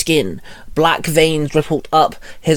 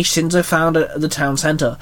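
ordinary delivery

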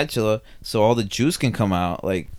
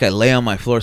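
ordinary delivery

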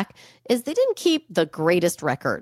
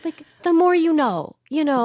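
higher, announcer-like tagline; mock PSA slogan; 7 semitones higher than usual. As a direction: quote the after-school special's slogan, higher. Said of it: "after-school special".

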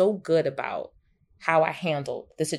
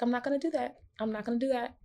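soft resolve; 7 semitones higher, 7 dB quieter, faster than usual. Retold or imitated. Mother refusing old-school scolding response.

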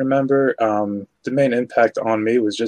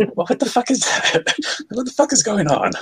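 pitched up high, baffled; mock disbelief; 8 semitones higher than usual. Reacting to the Twigman scene, mock baffled disbelief, pitched high. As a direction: play it like you can't believe what you're seeing.

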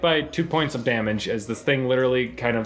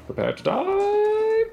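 zombie voice; slower than usual. Acted.

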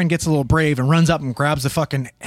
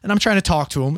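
normal talking voice

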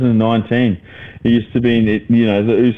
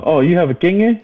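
mock girl voice; 8 semitones higher than usual. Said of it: "girls go up to".

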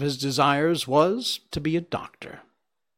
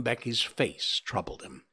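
usual speaking voice

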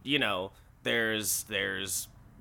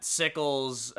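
usual speaking voice

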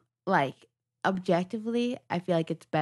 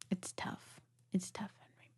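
unvoiced whisper; breathy whispered verdict; 15 dB quieter than usual. Real, not acted. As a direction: hush it like bad news.

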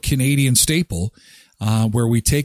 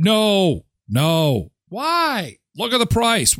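mock dad refusal; 6 semitones higher, slower than usual. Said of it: "retold dad answer".

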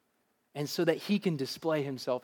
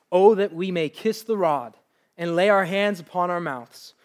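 letter read aloud with feeling; 6 dB louder than usual. Acted. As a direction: read the widow's letter with faith. Reads Sarah Edwards' letter with fervour.

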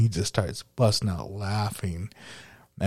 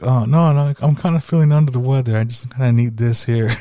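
loud, high, rushed fake-sick whine; student hamming up an excuse to the teacher; faked sick excuse; 4 semitones higher, 8 dB louder than usual. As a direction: play sick to keep the beanie on. Student faking sickness to teacher.

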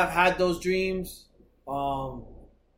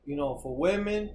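usual speaking voice

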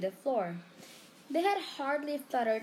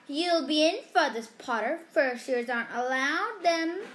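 gloating taunt; 5 semitones higher, 6 dB louder than usual. A narrator's reading. Malfoy gloats at Harry.

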